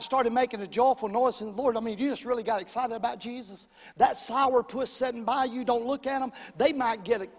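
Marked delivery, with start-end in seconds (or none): none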